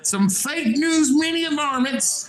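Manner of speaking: lightly voiced old cowboy, high; old prospector. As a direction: keep the frontier old-timer going. Continues cowboy character bit.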